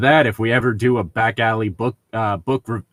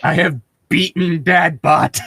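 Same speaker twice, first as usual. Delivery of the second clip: triumphant; 5 semitones higher, 5 dB louder than usual. Real, not acted. Triumph over game.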